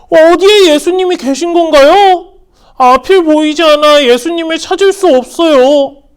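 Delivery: high, loud, urgent questions; eager blind beggar. Acted. Blind Bartimaeus begging the crowd to take him, urgent high. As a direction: beg to be led to Jesus.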